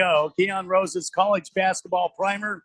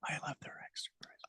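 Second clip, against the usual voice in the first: whisper; 22 dB quieter, much faster than usual. Pretend. Sudden hushed confession after gasp.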